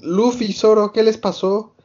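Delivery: alarmed cry over their injuries; worried crew. Retold or imitated. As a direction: sound shaken seeing the wounded friends.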